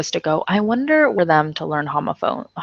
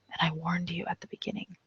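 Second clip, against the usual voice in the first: whisper; 14 dB quieter, faster than usual. Real, not acted. Teasing aside to class.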